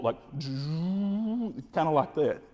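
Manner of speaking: slow imitated electric charge-up hum; drawn-out charging buzz. Shown as a demonstration. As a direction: mimic a blaster charging up, drawn out. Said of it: "like you're charging up an electric".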